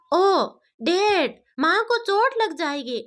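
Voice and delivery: put-on small child pleading, drawn out and high; a little girl fretting about her mom; worried little girl. A narrator's reading. Daughter to her dad, worried child voice.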